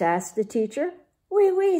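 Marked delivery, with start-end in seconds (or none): 1.3-1.8 high, bright poodle chorus in French; poodles speaking. Acted. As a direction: sing it out like prim French poodles. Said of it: "said the poodles".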